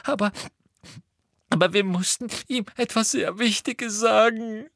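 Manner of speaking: high, wailing Hermine; whining. A narrator's reading. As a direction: plead through fake tears. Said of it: "jammerte Hermine".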